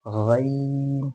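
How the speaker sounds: very slow drawn-out गैन from the throat; Arabic ghain. Shown as a demonstration. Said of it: "क्या करना है आपने?".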